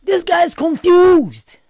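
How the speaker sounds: very high, slow, stretched-out cry; exasperated yell. Real, not acted. Reactor exclaiming at the boyfriend, exasperated yell. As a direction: wail it in disbelief, dragging it out.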